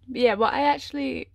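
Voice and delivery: ordinary talk, nothing marked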